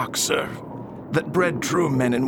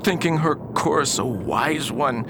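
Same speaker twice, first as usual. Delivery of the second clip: ordinary talk, nothing marked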